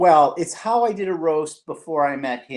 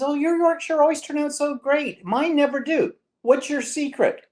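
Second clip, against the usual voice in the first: high mock old mother voice, much higher; imitating mother; 12 semitones higher than usual. Mother at dinner praises her daughter's Yorkshire puddings, high imitating voice. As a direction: play the admiring mother, pitched way up. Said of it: "And she says".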